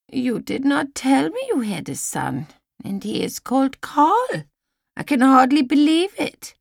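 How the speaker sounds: put-on high-pitched old woman, eager to tell; old Lizzie. A narrator's reading. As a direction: play an excitable old woman. Old lady voiced in story.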